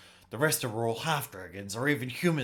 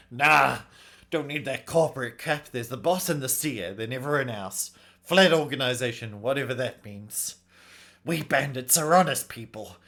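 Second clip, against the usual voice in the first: louder, opinionated bandit; brash, proud bandit; 3 semitones higher, 7 dB louder than usual. Acted. Bandit defending honest bandit work, brash and proud. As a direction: play a bandit holding forth, scornful.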